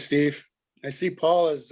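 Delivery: ordinary delivery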